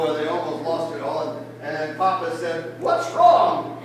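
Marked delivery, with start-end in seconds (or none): 2.8-3.8 high, loud acted question; big theatrical query; concerned call. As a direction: call out as a startled storybook papa. Father asks, acted loudly.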